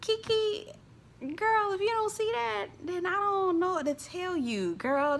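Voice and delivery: exasperated aside to Kiki. Real, not acted. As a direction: sigh it at her, exasperated.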